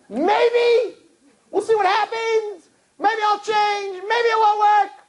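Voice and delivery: very high, slow, dragged-out hedging; hammed-up evasive boyfriend; mock noncommittal. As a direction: sing out the shrug.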